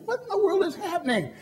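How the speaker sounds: high, baffled exclaim with a laugh; bewildered outburst. Retold or imitated. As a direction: squeak it out in amused disbelief. Puzzled dad sees show stop.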